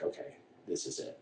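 breathed out, almost silent, resigned; hushed resignation. Retold or imitated. Son's private thought on hearing of mom's cancer, hushed resignation. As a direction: whisper it to yourself, accepting the worst.

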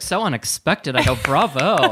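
loud, high, amused praise; mock applause. Rider praising the drunk man's word choice, loudly amused. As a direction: cheer him on ironically. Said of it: "I was like".

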